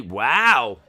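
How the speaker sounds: loud, swooping wow of surprise; surprised wow. Real, not acted. Stream host reacts to the surprise win, astonished.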